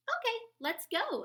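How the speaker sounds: high, loud eager yes; eager agreement. A narrator's reading. Pip eagerly agrees to meet the bats, high and loud. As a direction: jump at the invite. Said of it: "agreed Pip".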